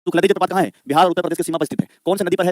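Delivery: ordinary talk, nothing marked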